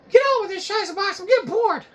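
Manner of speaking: a bored audience member pestering the host; heckling viewer voice. Acted. Heckling viewer whining high at the host. Heckler voice addressing Shiza Box.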